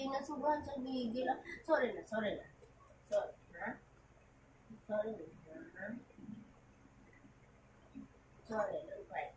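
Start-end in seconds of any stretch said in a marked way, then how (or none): 1.6-9.3 softer, lower, calming sorry; soothing apology. Real, not acted. Soothing baby after biting.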